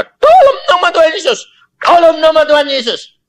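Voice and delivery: high, loud urgent prayer; fervent healing prayer; praying pastor. Retold or imitated. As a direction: plead in Jesus' name over the child. Pastor praying over dead child.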